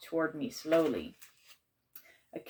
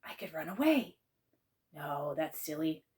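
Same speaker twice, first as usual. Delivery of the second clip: ordinary delivery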